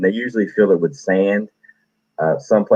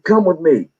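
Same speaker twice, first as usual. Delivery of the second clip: much higher clipped command; drill sergeant order; 10 semitones higher than usual. Retold or imitated. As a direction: order him to follow.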